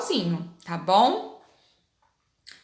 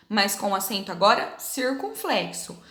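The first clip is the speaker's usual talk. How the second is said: usual speaking voice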